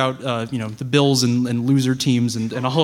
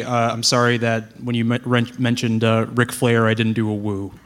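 ordinary talk, nothing marked

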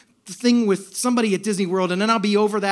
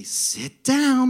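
higher, cheery singsong instruction; joyful angel; 5 semitones higher, 4 dB louder than usual. Retold or imitated. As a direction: lift it sweetly like a happy ride attendant. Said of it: "and it was joyful".

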